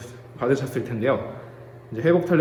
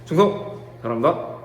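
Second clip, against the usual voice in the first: crisp barked salute, pitched up; military salute. Acted. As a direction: snap out the salute like a soldier. Soldier salutes on camera.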